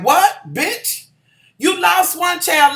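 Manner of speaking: high-pitched, incredulous challenge; outraged disbelief. Acted. Telling off the first lady over her child, outraged disbelief. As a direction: call her out, pitch jumping up.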